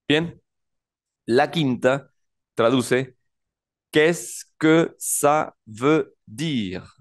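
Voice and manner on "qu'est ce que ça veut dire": very slow, each word separated by pauses. Shown as a demonstration. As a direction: model «Qu'est-ce que ça veut dire» slowly for learners. Teacher models a new French phrase.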